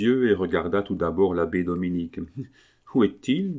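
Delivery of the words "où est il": high, puzzled question; astonished. A narrator's reading. Woman waking from a faint asks her friend, astonished. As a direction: ask with mild bewilderment, as if just coming to. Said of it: "demanda-t-elle d'un air étonné".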